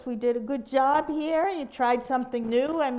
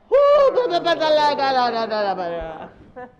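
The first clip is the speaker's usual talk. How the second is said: loud, babbling silly exhale; silly sound; 7 dB louder than usual. Shown as a demonstration. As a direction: let out a goofy gibberish burst. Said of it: "say a silly sound when you breathe out".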